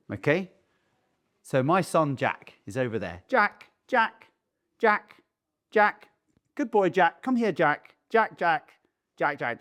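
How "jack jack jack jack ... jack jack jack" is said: high-pitched calls, as if summoning a dog; mock owner voice, repeated name and praise; imitating dog-style calling. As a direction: call your kid like a pet.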